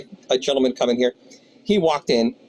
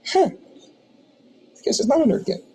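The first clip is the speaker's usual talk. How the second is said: stunned amazement; 9 semitones higher than usual. Retold or imitated. Realizing customer is a Pittsburgh Steeler.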